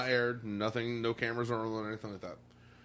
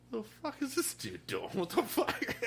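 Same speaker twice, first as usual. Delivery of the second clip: much higher, baffled mock voice; incredulous viewer; 11 semitones higher, 3 dB quieter than usual. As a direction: squeak out confused disbelief. Imagined viewers' reaction.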